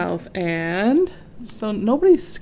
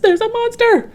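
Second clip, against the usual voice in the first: very high, loud cry of alarm; alarmed elephants; 6 dB louder than usual. A narrator's reading. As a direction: shout the warning while fleeing. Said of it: "called the elephants".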